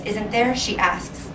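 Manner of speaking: plain reading voice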